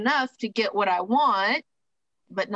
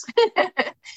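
short laugh; 12 semitones higher than usual. Real, not acted. Laughing at drunk logic.

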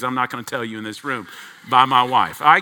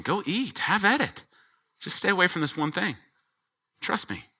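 softer, high and quick; gentle fatherly; 5 semitones higher, 6 dB quieter than usual. Acted. Father softly urging the child to trust him, tender. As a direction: reassure like a loving father.

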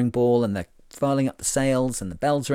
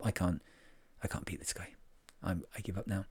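mock defeated mumble; 4 semitones lower, 15 dB quieter than usual. Mock despair at French rival.

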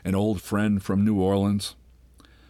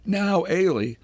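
upset echo; 10 semitones higher, slower than usual. A narrator's reading. Ailey repeating his words, upset.

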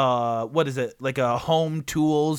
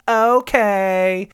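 a long, louder okay, voicing the boy; drawn-out okay; 5 semitones higher, 6 dB louder than usual. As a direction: stretch the okay out as the kid gives in. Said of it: "And [name] is like".

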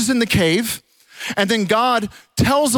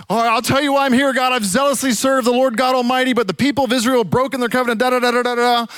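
fast, higher-pitched run-through of a rehearsed complaint; hurried retold grievance, pitched up; rattled-off recitation; 6 semitones higher, faster than usual. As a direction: reel it off quickly, trailing into da-da-da. Elijah rattles off same old complaint.

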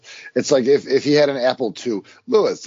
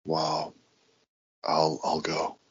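whisper; 8 semitones lower, 5 dB quieter, slower than usual. Acted. Meek exit whisper.

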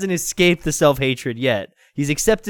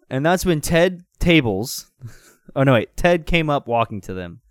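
ordinary delivery

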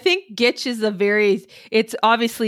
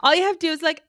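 ordinary talk

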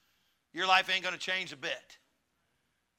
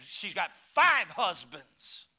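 slow, drawn-out revelation; emphatic; much slower than usual. Acted. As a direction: lay each word down slowly. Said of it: "Miss Coach".

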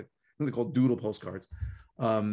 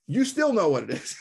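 high, louder mock insistence laughing at it; imitating a stubborn caller; exasperated caller; 7 semitones higher, 5 dB louder than usual. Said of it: "She's like".

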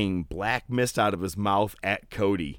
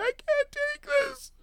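strained squeal. Retold or imitated. Voicing wrestler in lockjaw.

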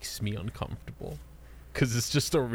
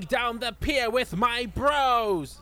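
high, slow hammed-up shouting; [name]; 9 semitones higher, 3 dB louder, slower than usual. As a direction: bellow it like a theatrical villain. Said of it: "you don't gotta scream it".

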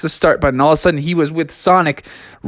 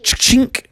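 imitated cartridge clicking in; sharp unvoiced click-clunk noise; 6 semitones higher than usual. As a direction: mouth the sound of a cartridge slotting in. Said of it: "So he put in the cartridge".

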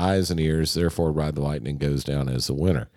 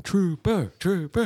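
mock fan chant, pitched way up; imitated crowd yelling; 14 semitones higher, slower than usual. Bandmate voices fans requesting a song, imitated high yelling. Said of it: "to start yelling".

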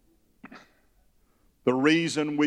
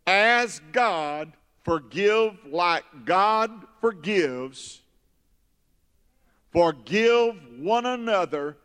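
slow, emphatic, drawn-out declamation; preached scripture; 4 semitones higher, slower than usual. Real, not acted. Preacher driving home God's forgiveness, slow emphatic preaching. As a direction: lift each phrase and stretch it slowly.

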